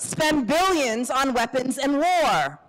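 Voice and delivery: slow high call to the crowd; rally the room with a prompt. Shown as a demonstration. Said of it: "calling prompts to the crowd".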